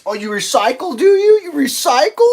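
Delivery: high, loud sneering mockery; mocking sarcasm. Show's people mocking recyclers, high sarcastic sneer. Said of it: "It's like".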